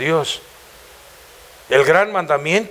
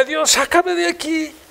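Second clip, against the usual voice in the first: much higher, slow, pleading cry; tearful plea; 9 semitones higher, slower than usual. Retold or imitated. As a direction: beg God to get you out, voice breaking. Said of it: "yo lloraba y decía". (súplica llorosa y lenta a Dios)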